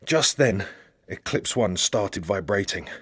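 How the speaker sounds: ordinary delivery, nothing marked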